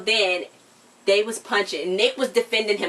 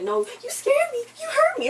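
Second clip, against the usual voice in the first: pitched way up, whiny and hurt; high mock Mariana voice. Friend retelling Mariana's panic, high whiny voice. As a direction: squeak it out like a scared girl. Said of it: "She's like".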